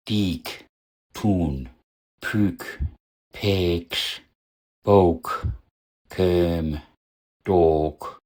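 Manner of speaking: Diek, Tun, Pük, Peksch, Bouk, Köm, Dok with long vowels; isolated demo words, hold each vowel long. Shown as a demonstration. Teacher lists phonologically long vowels, long-vowel demo. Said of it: "Lange Vokale".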